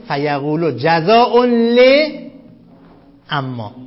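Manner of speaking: فیقولو جزاء ل dictated slowly, word by word; slow dictation to students. Shown as a demonstration. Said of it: "این فیقولو تو گیومه قرار بدید".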